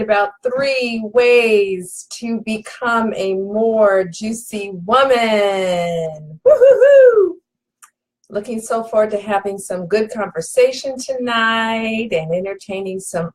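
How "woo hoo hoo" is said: high, loud celebratory woo-hoo; real giddy cheer; excited whoop. Real, not acted. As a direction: whoop it up for the crowd. Hyping up the live audience.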